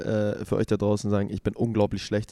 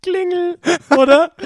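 excited guess; 11 dB louder than usual. Real, not acted.

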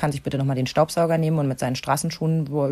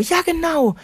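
put-on eager kid; 11 semitones higher, 4 dB louder, slower than usual. Kind stimmt zu.

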